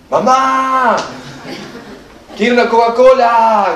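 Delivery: put-on a little boy shouting from his bed; child yelling. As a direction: bellow for mom like a spoiled child. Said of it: "grita así".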